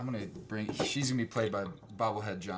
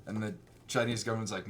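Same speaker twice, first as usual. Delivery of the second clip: ordinary delivery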